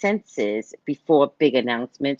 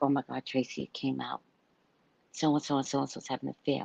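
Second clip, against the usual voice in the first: much softer, quick confiding gossip; hushed gossip; 10 dB quieter, faster than usual. Retold or imitated. Neighbors tell Tracy the affair news, softer and hushed. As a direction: lean in and spill it quietly.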